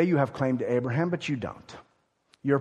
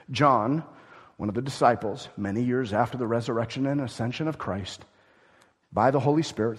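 ordinary talk, nothing marked

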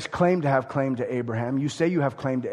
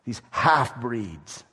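slow sneering slur, dripping contempt; scornful; 5 semitones lower, much slower than usual. Retold or imitated. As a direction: drawl it out with disdain. Contemptuous label for the Samaritans.

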